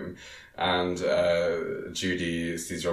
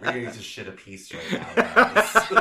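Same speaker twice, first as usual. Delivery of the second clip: giggling through the words; laughing mock line; 12 semitones higher, 7 dB louder than usual. Real, not acted. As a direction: laugh while you say it. Mocking line while laughing.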